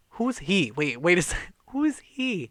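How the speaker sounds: startled, demanding to know; alarmed. Retold or imitated. Friend startled by a stranger, alarmed questions.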